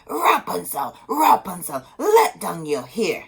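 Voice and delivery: loud sorceress call up the tower; commanding witch's call. A narrator's reading. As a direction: call up to the window. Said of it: "she called out".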